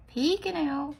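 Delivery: PEEKING lifted high and punched like peekaboo; playful peekaboo. Pretend. Nail artist playing with the little guy, playful peekaboo.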